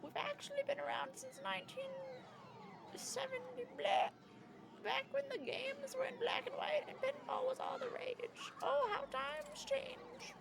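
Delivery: put-on high, quavery old lady. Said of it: "I'm very bad at voices".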